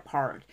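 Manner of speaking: PART with the last T barely sounded; "part" trailing into a light T; weak final T. Shown as a demonstration. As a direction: soften the end of PART. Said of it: "뒤에 to도 약하게".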